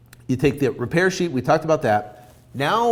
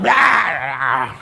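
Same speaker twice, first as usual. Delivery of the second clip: mock freak-out; 5 dB louder than usual. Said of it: "Seller freaks out".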